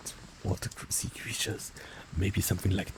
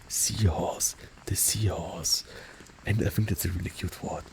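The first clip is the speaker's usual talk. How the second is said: ordinary delivery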